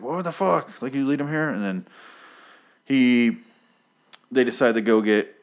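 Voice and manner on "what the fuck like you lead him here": high, startled mock yell; startled Rick. Rick startled by Jesus in his bedroom, mock yell. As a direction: blurt it out in shock. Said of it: "He's like".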